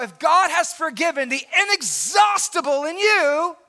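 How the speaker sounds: high, loud, drawn-out gospel proclamation; full-force preaching. Real, not acted. Preacher proclaiming to the church that their debt is paid, full-force preaching. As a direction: preach it loud and high, stretching the key words.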